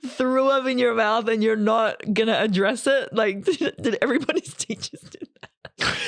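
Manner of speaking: high, laughing disbelief. Real, not acted. Laughing at teachers who burped mid-lesson. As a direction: giggle through the question. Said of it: "you laughs just".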